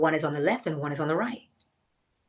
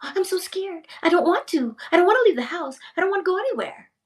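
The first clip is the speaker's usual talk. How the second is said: much higher, fretful mock fear; a hammed-up scared whimper, pitched way up; 11 semitones higher, faster than usual. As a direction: play it like you're too scared to leave the house. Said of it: "If you are afraid, fear ... If you say".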